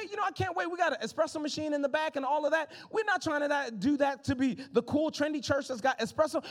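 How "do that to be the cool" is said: ordinary delivery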